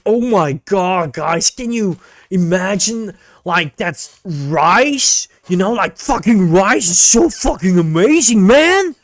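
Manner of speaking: hammed-up bro hype building to a shout; mock overreacting dude. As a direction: parody a bro losing it over rice. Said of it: "overreacting on stupid shit".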